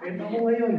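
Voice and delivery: big high laugh into words; loud high laughter. Real, not acted. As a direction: laugh as you say it. Laughs at outcome.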